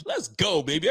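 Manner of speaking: high, hyped cheer of joy; let's go shout for the Bills; excited cheer. Real, not acted. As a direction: cheer it out for the team. Celebrating sacks.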